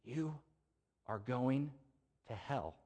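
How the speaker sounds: hushed mock whisper in the ear; a soft, secret warning. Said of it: "he whispers in his ear".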